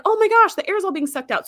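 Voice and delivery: high-pitched mock alarm; retold alarm. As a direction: recount a gasped oh-my-gosh moment. Recounting an alarmed reaction.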